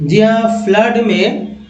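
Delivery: usual speaking voice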